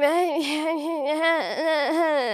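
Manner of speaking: hammed-up wordless drunk sobbing; long imitated crying whine; mock drunken crying. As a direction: blubber high and helplessly, for laughs. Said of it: "And I'm like".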